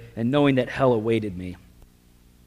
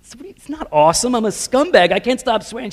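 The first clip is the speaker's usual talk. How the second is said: higher, faster, a bit louder self-scolding; exasperated protest; 8 semitones higher, 5 dB louder than usual. Retold or imitated. Young convert protesting to his girlfriend, exasperated and fast. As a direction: blurt it out in frustration.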